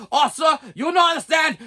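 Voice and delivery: high mock shout in a foreign accent; shouted, high-pitched accented protest; imitated yelling. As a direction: mimic an agent yelling over the caller. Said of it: "he's yelling at me".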